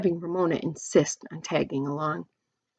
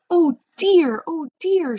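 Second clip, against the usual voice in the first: fussy little steam shovel; 9 semitones higher, 5 dB louder, much slower than usual. A narrator's reading. Child imitating steam shovel.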